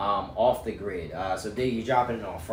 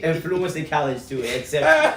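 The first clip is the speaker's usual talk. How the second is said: laughing, louder mock parent lecture; mock strict parent; 5 semitones higher, 8 dB louder than usual. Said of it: "I'm gonna be like".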